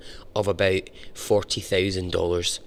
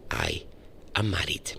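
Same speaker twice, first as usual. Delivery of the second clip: whispered; 5 semitones lower, 8 dB quieter than usual. Retold or imitated. Whispered end of quoted confession.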